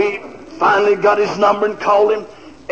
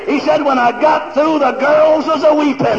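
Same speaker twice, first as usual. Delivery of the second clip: loud, high-pitched revival shouting; full-force preaching; 7 semitones higher, 4 dB louder than usual. Real, not acted.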